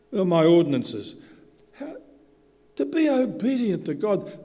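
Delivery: high, loud, fervent preaching; loud, laughing sermon rant; full-force preaching. Acted. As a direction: drive the point home from the pulpit.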